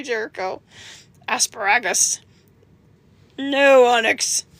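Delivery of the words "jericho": ordinary delivery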